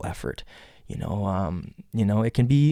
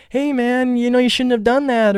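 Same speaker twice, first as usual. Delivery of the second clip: casual friendly; 12 semitones higher than usual. Retold or imitated.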